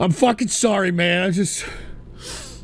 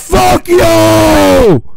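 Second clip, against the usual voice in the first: furious shout; 6 semitones higher, 11 dB louder than usual. Acted. Rage at donation soundbite.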